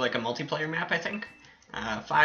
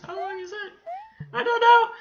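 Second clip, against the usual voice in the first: silly squeaky voice. Pretend. Gamer wondering how long the map is, silly squeaky voice.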